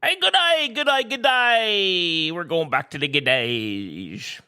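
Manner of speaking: mock Irish, high and drawn out; hammed-up Irish accent, stretching the vowels; bad Irish accent. Greeting podcast listeners in a put-on Irish accent. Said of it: "That was me Irish accent".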